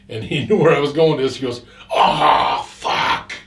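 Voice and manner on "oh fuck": loud voiceless groan; dismayed. Retold or imitated. Friend realizes the jackets are gone, dismayed. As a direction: imitate an exasperated, breath-heavy curse.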